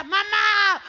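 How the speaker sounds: loud, high nasal wail; mock crying. Boy comes home to mother, loud mock-crying gangoso wail. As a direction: wail for mamá through the nose, hammed-up. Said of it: "el nene llega llorando".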